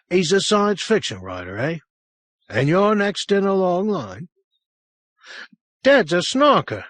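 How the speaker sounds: put-on a worried boy speaks up; young boy voice. A narrator's reading.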